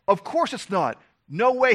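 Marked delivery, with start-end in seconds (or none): none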